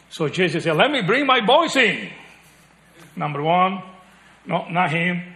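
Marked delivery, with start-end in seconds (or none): 0.8-2.1 eager father. Retold or imitated. Jesse voiced in retelling.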